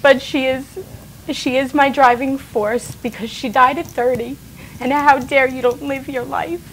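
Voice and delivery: fighting not to cry while speaking; holding back tears. Real, not acted. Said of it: "I did wanna get through this without crying".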